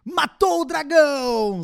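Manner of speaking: loud, high, drawn-out caster call; esports caster. Retold or imitated. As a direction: hype it like a game narrator. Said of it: "é tipo assim, ó". (narrador de esports)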